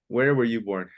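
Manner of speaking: normal talking voice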